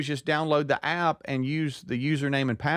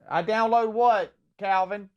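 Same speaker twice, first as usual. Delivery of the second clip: confused mom; 8 semitones higher than usual. Retold or imitated.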